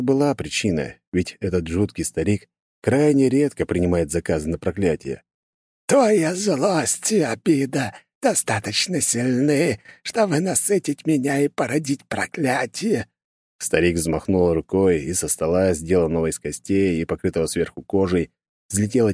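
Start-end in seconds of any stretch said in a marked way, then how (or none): 5.9-13.1 put-on high, slow, creepy old sorcerer; eerie voice. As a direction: drag each word out like a ghoulish old man. Sinister old curse-maker voiced.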